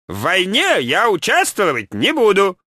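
резкий высокий голос вождя; отрежь с обидой, высоким голосом; резко, обиженно. A narrator's reading. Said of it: "резко отрезал вождь и обидчиво отвернулся". (обиженный вождь, резко)

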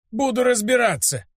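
высокий стариковский голос с раздражением; командуй ученику раздражённо, но негромко; раздражённый старик. A narrator's reading. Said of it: "рявкнул раздражённо". (раздражённый старый учитель)

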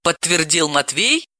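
usual speaking voice

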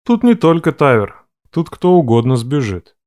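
deep calm male voice; low, measured male tone. A narrator's reading. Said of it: "высказал общую мысль Реф". (низкий мужской голос)

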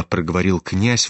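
plain reading voice

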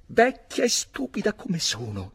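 voce acuta e stridula di dama furiosa; milady fuori di sé, tutta in alto; sfuriata di milady. A narrator's reading. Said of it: "continuava la sua sfuriata con grida sconnesse". (milady inveisce contro il marito, voce alta e furiosa)

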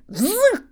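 sudden high squeal; holy fool's shrill outburst; shrill cry. Acted. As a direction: yelp it out wild. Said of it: "кричит монах".

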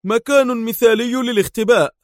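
high-pitched, forceful declaration; hard, high-pitched verdict; stern. A narrator's reading. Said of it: "ولوح به في صرامة قائلًا".

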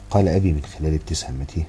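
ordinary delivery

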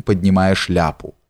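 plain reading voice

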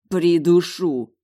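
loud, slow, drawn-out threat; seething threat. A narrator's reading. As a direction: grind out the threat slowly. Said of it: "процедил парень". (угрожающе процедить)

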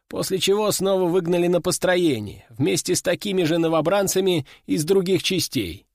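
ordinary delivery, nothing marked

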